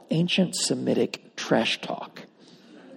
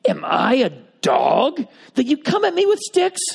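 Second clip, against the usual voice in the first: lightly voiced scornful giant, a bit higher; Goliath taunting; 9 semitones higher, 3 dB louder than usual. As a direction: sneer it like a mocking giant. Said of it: "he said to David".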